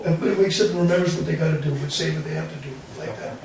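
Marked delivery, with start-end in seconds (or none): none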